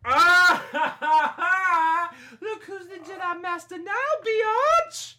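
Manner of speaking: loud, high triumphant cackle; gloating laugh. Real, not acted. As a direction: crow over him, laughing. Triumphant after stabbing rival.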